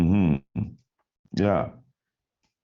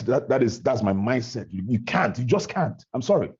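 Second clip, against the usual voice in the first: normal talking voice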